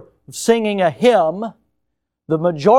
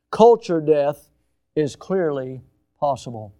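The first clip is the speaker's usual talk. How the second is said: dramatic quote reading; 3 semitones higher, 4 dB louder than usual. Acted. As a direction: let the warning fall away at the end. Reading quote number four.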